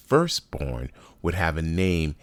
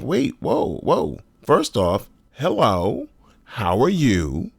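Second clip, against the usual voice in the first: surprised; 6 semitones higher, slower than usual. Retold or imitated. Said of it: "I was like".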